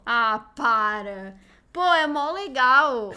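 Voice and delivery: loud and drawn out; exasperated fan, slow and loud; indignant protest. Real, not acted. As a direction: plead the film's case, stretching the words. Protests friend's dismissal of film.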